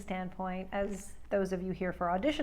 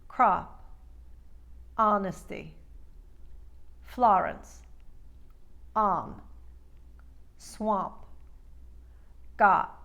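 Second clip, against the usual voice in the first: Brooklyn and Bronx slightly widened o; careful Bronx word-list demo; Brooklyn accent; slower than usual. Shown as a demonstration. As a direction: open each o a little, Brooklyn style. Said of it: "Brooklyn and Bronx being the first variation".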